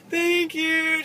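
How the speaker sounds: high, loud, drawn-out thank-you; imitating delighted servers, sung out brightly; beaming thanks. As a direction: gush it with a huge smile. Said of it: "just with, like, these huge smiles".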